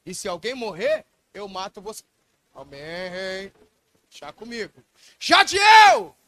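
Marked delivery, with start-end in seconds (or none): 5.2-6.2 calling out. Acted. David calls out to Jadiel.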